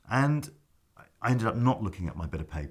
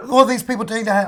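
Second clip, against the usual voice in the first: much higher and louder, laughing through it; shocked exclamation; 12 dB louder than usual. Retold or imitated. Actor seeing the packed house, laughing loud shock. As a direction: blurt it out in amazed disbelief. Stunned by the full audience.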